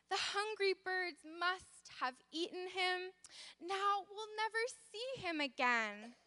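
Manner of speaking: high, slow storybook sorrow; tearful lament. A narrator's reading. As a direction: lament it mildly for young listeners. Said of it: "they started to cry".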